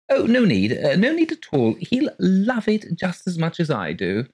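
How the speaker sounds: high, gushing genteel lady voice; gushing landlady. Acted. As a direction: pour it out sweetly and breathlessly high. Mrs Colgate gushing.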